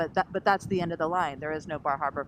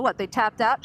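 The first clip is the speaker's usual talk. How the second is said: louder imitated indignant yelp; mock angry passenger; 5 semitones higher, 8 dB louder than usual. Angry passenger asking about the crew, mock indignant. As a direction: throw it out as the outraged passenger. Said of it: "he was all mad".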